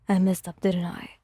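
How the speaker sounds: a hint of sheepish regret; tinged with regret. Bakugo admitting his mistake to Wyon, mild regret. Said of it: "his voice tinged with regret".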